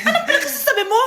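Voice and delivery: laughing, quick scolding; a teacher laughing at a silly answer; laughing disbelief. Acted.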